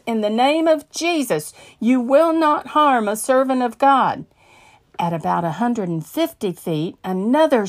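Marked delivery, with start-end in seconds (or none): 0.0-4.3 yelled rebuke. Retold or imitated. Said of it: "and yelled".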